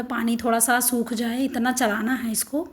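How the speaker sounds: usual speaking voice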